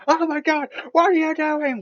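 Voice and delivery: high-pitched, loud panicked squeal; mock screaming. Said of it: "other one's just screaming".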